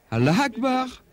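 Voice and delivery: calling out high, as a preacher in the market square; imitated street cry. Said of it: "et il crie". (cri lancé plus aigu, comme un prêcheur sur la place du marché)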